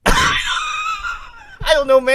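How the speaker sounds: high squealing laugh through words; laughing shriek, caught out. Real, not acted. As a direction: crack up while dodging.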